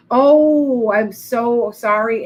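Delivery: real gasp, then a slow, sorry oh; sudden gasp into a slow apology; startled apology. Real, not acted. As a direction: caught off guard and apologising. Missed a viewer's question.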